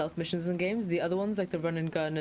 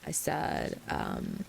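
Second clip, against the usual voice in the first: slow Californian drawl with vocal fry; creaky, stretched-out Californian accent; Casey; slower than usual. Retold or imitated. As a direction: draw it out like a vocal-fry Cali girl. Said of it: "she talked like this".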